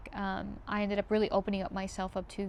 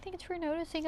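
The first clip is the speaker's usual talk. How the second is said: mock high voice; 8 semitones higher than usual. Retold friend thanking her.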